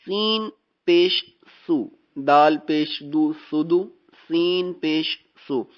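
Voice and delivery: slow syllable-by-syllable spelling, whistle on سین; teacher models سین پیش سو with a clear hissing س; slow spelled recitation. Shown as a demonstration. Teacher models Quran spelling for the student, slow and precise. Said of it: "سین دونوں طرف آ رہا ہے".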